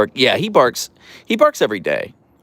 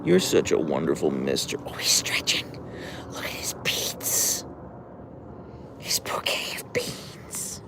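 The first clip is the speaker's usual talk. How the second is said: whisper; 7 semitones lower, 10 dB quieter than usual. Real, not acted. Owner whispers fondly while her dog stretches.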